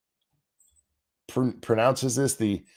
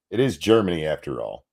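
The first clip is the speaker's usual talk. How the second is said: ordinary talk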